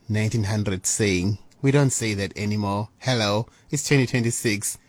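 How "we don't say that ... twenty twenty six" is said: usual speaking voice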